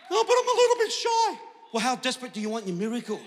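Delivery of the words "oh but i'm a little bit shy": high, loud mimicked excuse; mocking whine. Pastor mocking a shy member's excuse, high whining. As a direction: make the excuse sound ridiculous, much higher.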